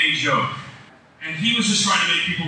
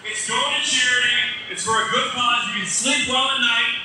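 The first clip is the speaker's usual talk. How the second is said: auctioneer patter; 5 semitones higher than usual. Acted. Charity auction selling blanket.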